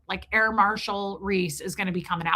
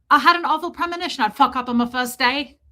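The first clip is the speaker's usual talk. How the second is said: louder imitated movie voice; film heroine impression; 2 semitones higher, 5 dB louder, faster than usual. Quoting a nervous film woman on her first day, imitated. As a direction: put on the actress's voice.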